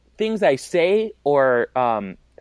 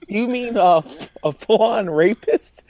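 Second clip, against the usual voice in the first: higher, lightly voiced Charlie-style question; imitating Charlie; 5 semitones higher than usual. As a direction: mimic the confused character, half-laughing. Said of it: "and he's like".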